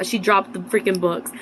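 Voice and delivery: ordinary talk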